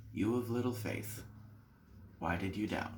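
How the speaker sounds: gently ask, not scolding; gentle. Acted. Jesus gently speaks to Peter.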